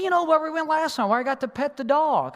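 put-on a little boy getting impatient; imitating his son. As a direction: son insisting to his dad, childlike pleading. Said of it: "He said".

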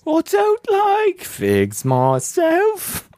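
very high, hammed-up English lady voice; subdued English lady. Greeting the ladies for the listener, high English lady accent. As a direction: falsetto mock English lady, stretched out. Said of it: "subdued English lady".

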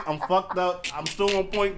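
normal talking voice

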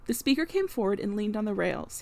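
plain reading voice

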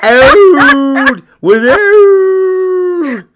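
very high, loud bellowed call; loud moose-call imitation of without. Real, not acted. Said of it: "Are you doing a moose call again?".

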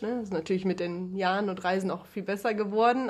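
ordinary talk

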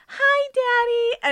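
put-on high, loud, drawn-out child voice; little girl greeting. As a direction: imitate a child chirping to her father. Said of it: "suddenly she's just like".

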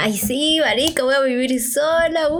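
a touch of squealy delight; excited. Retold or imitated. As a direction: sell the giddy excitement of moving out. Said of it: "yo primero estaba emocionada".

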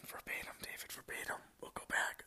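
breathy whispered self-reminder, very soft. Real, not acted. Host whispering a reminder to herself to read verbatim. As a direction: hush it to yourself like a private note.

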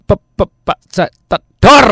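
mock stutter, choppy broken syllables; stuttering imitation. Imitating a stuttering student, choppy and halting. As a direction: imitate a student who can't get words out. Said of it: "enggak bisa ngomong gitu".

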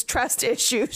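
breaks into a laugh mid-phrase; words cracking into laughter; laughing through words. Real, not acted. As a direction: giggle through the word. Laughs mid-word retelling comic.

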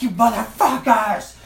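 very high, loud, slow furious yell; Clark Griswold scream. Retold or imitated. Driver slamming the brakes to scream at the passengers, furious. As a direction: blow up like a dad at Wally World. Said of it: "screamed at him like I was Clark Griswold".

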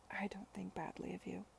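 quiet, tender reassurance; soft whisper. Acted. Charlotte reassuring Sidney at parting, whispered. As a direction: whisper it close to him.

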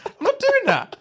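very high-pitched, dismissive protest; mock refusal. Playing an English speaker refusing to learn languages, comically indignant. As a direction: refuse as if it's beneath you, hammed-up. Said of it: "I'm so ignorant".

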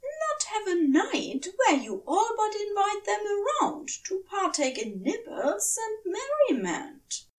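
the prim angel raising an earnest objection; Aziraphale protesting. A narrator's reading. Narrator voicing Aziraphale objecting to Crowley, high and fussy. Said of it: "Aziraphale had protested".

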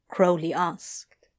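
ordinary delivery

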